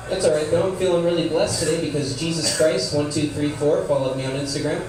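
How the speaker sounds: normal talking voice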